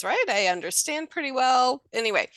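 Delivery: normal talking voice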